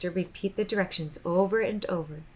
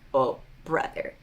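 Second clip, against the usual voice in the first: ordinary delivery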